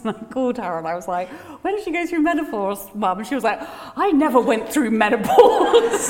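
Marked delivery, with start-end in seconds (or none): 1.6-3.2 high-pitched question. Retold or imitated. Phoning mum, asking in a high disbelieving voice. 4.0-6.1 mock indignant mum.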